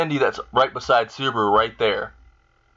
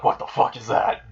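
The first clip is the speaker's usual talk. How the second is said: scared. Retold or imitated. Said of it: "They're, like, very scared".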